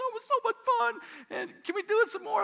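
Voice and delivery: put-on squeaky-high eager kid voice; excited little boy. Boy to dad after the four-wheeler ride, imitated high excitement. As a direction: imitate a child begging for another go.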